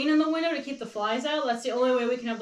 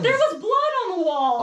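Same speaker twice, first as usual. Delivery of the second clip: laughing shout; 5 semitones higher, 6 dB louder than usual. Real, not acted. Teasing outburst at husband.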